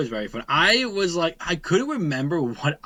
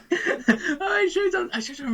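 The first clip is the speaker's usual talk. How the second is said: laughing; 3 dB louder, slower than usual. Real, not acted. Laughing at the Resident Evil idea.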